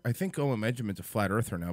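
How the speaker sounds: normal talking voice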